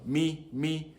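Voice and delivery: "mi, mi" held on a high tone, softly. Shown as a demonstration. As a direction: lift "mi" softly and keep it up. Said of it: "which is the high tone".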